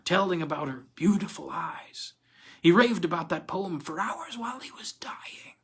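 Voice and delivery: breathy unvoiced whisper; whispering in the dark cell. A narrator's reading. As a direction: hush it so nobody hears. Prisoner's hushed recollection.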